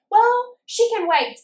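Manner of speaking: high, slow, snooty drawl of a cold receptionist; dismissive mock receptionist. Imitating the dismissive receptionist who says she can wait. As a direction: mimic her brushing you off.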